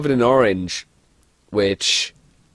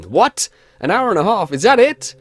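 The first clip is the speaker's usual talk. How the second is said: rising disbelief, louder; can't believe it's so short; incredulous; 10 semitones higher, 6 dB louder than usual. Real, not acted. Mocking the short playtime.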